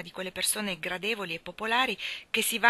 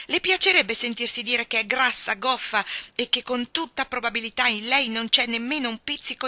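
louder and higher, building to screamed mai; a child's rage swelling to a shout; furious tirade; 4 semitones higher, 8 dB louder than usual. Acted. Anna builds her fury at Rachel Lynde to a shouted never.

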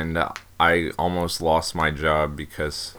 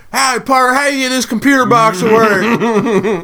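high, loud, slow Southern drawl imitation; loud Southern trucker; 8 dB louder, slower than usual. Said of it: "loud Southern guy".

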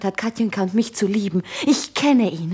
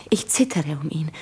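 whispered, lower confession of fear; hushed dread; 6 semitones lower, 3 dB quieter than usual. Acted. Woman confiding her fear for him to Jura, hushed. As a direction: let the worry sink into a low whisper. Fears for endangered man.

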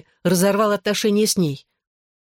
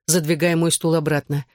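ordinary delivery

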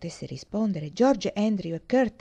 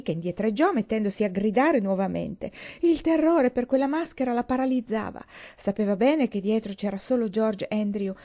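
usual speaking voice

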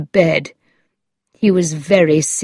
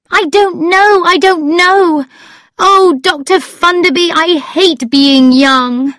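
high, loud outburst, dragging at the end; distressed cry; 12 semitones higher, 6 dB louder than usual. A narrator's reading. Upset girl confiding in the doctor, crying out. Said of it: "she cried".

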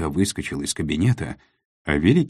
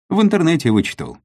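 proud; 13 semitones higher, 7 dB louder, faster than usual. A narrator's reading. Kopyto answers proudly.

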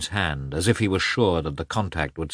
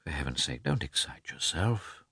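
much softer, soothing character voice; hushed calming; 8 dB quieter than usual. A narrator's reading. Oblonsky calms his shrieking brother-in-law, hushed. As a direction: drop the volume to calm him down.

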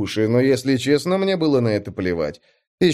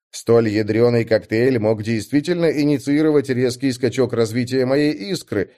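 plain reading voice

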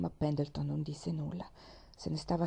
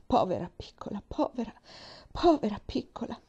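voice breaking; 4 semitones higher than usual. A narrator's reading. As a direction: let the voice crack more with each povera. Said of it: "con voce rotta dall'emozione".

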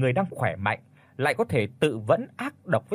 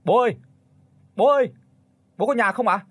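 high, raised calling voice; loud, high hail to someone indoors; calling out loudly; 9 semitones higher, 4 dB louder, faster than usual. A narrator's reading. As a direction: call for Dad from outside the house. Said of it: "Anh Nhất gọi lớn".

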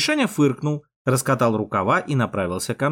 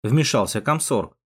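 usual speaking voice

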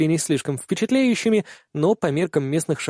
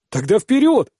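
eager shout; 7 semitones higher, 4 dB louder than usual. Acted. Kihara urging takeoff.

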